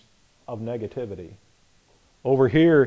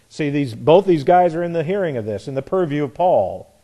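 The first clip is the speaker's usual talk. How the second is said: ordinary delivery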